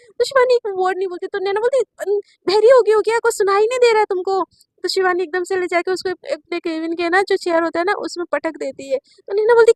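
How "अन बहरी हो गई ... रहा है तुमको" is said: loud, high scolding voice; angry scolding. A narrator's reading. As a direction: snap at her for ignoring you. Angry at silent Shivani. (झुंझलाकर ऊँची आवाज़ में डाँटना)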